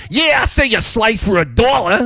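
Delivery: high, shouted New York street vendor; New York accent. Retold or imitated. Street seller calling out prices, shouted in a New York accent. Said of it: "someone who's like".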